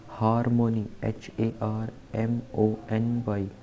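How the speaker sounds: ordinary talk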